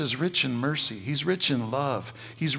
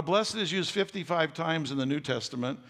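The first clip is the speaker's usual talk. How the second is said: ordinary delivery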